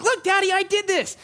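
high, eager puppy voice; proud dog voice. Pretend. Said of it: "She's like".

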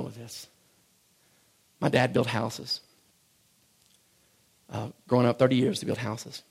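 soft personal testimony; quiet confession. Acted. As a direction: drop to a hush and confide in them.

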